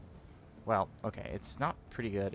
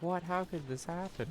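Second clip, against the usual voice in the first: mock disbelief; 10 semitones higher than usual. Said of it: "They're like".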